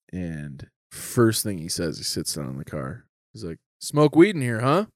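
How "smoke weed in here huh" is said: imitating dad's confident angry accusation; raised, pointed mock-dad challenge; confident anger. Dad catching his stoned kid, confident anger. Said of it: "that confident angry is terrifying".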